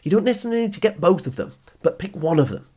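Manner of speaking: usual speaking voice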